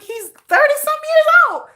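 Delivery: high, disbelieving reply; incredulous retort. Retold or imitated. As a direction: act baffled at the fuss, up high. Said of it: "I'm like".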